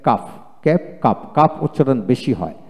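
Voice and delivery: ordinary delivery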